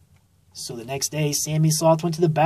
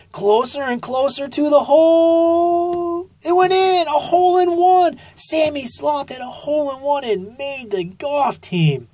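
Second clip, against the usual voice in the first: rising higher and louder; building excitement; 10 semitones higher, 3 dB louder than usual. A narrator's reading. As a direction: build up to the big win. Narrator builds to hole in one.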